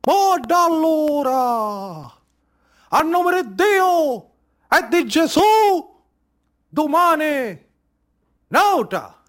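slow, hushed, declaimed; solemn invocation. Acted. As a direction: call on God like a ritual. Solemn invocation after the slaughter. (invocazione solenne e lenta)

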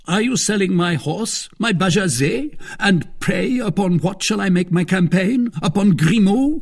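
high, loud, outraged questions; indignant cry. Acted. Athos learning his horse is being sold, indignant. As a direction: protest in affronted disbelief. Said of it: "cried Athos".

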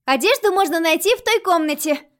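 put-on high, loud chirpy girl; chirping. A narrator's reading. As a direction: chirp it out brightly. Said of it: "прощебетала Айрис".

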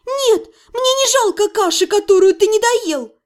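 high, loud tearful yell; shouting. A narrator's reading. Heroine shouting at the healer, near tears. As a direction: shout to hold back tears. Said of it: "закричала я".

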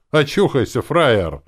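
loud, high-pitched jeer; snorting scorn. A narrator's reading. Uncle telling the narrator to wake up, scornful. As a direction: throw the insult at him forcefully. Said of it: "фыркнул дядя Фрол".

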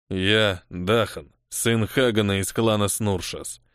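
low, slow heavy voice; a slow, weighty bruiser; gruff brute voice. A narrator's reading. Brute northerner introduces himself.

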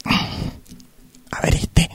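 slow whisper to herself. Acted. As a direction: breathe it out to yourself as you look. Customer checking the pants. (susurro lento para sí misma)

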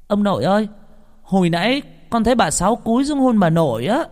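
put-on high-pitched child's voice; excited little boy. Little boy reporting to grandfather, high excited call. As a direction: pipe up high like a small child.

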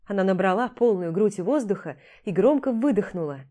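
ordinary delivery, nothing marked

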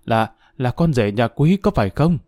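ordinary delivery, nothing marked

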